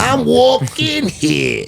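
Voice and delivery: slow high New York "I'm walking here"; hammed-up New Yorker line, drawn out; New York accent. Guy doing a movie New Yorker for friends, hammed-up accent. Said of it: "That's a little better".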